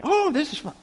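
high, delighted exclamation; upbeat, high-pitched mock delight; imitated happiness. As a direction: act out a cheerful pastor's glee. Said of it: "He gets happy".

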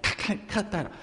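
ordinary delivery

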